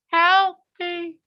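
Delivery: very high, small plea; tiny distant voice. Acted. Trapped man calls to husband, tiny high voice. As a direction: squeak it like someone trapped far inside. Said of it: "And he hears".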